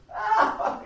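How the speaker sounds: loud, high put-on guffaw; mocking laugh. Lawyer laughing at the girl, mocking. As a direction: burst out in an acted jeer. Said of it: "He's laughing".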